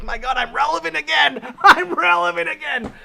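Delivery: high, loud, laughing delight; giddy laughing joy. Retold or imitated. Washed-up streamer thrilled to be accused, laughing high and loud. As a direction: laugh out the good news, hammed up. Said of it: "I'd be like".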